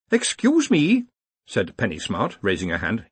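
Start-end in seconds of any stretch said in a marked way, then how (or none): 0.1-1.0 high-pitched, rising interjection; high querying professor; polite interruption. Acted. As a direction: cut in politely with a lifted voice. Penny Smart interrupts.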